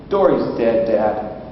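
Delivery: put-on low, matter-of-fact child's answer; deadpan child. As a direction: imitate a kid breaking news without any fuss. Daughter calmly reports the death.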